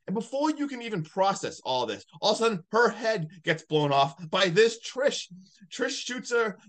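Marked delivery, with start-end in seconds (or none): none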